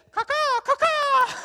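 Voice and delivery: high, loud bird squawk "caca, caca"; squawking bird call. Retold or imitated. Son answering his sister with a loud bird squawk. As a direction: squawk it like a crow. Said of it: "And my son says".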